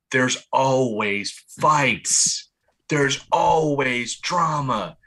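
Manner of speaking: slow, word-by-word emphasis; each line spelled out slowly; slow emphatic. Real, not acted. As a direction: lay it out slowly to drive it home. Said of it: "stressing a point".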